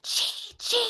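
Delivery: put-on urgent child's whisper. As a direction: hiss the name to get attention. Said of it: "by whispering".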